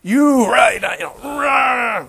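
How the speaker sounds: high, very loud wordless-edged rant; imitated angry yelling. Rinpoche telling off the student at the door, mock yelling. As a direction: roar it as a hammed-up scolding. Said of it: "He starts yelling at me".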